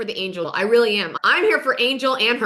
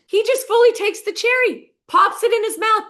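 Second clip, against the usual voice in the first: much higher and louder, incredulous; exasperated disbelief; 10 semitones higher, 5 dB louder than usual. Real, not acted. Telling how Todd took Bronwyn's cherry, loudly incredulous. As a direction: push it up high and loud in outrage. Outraged retelling of the cherry theft.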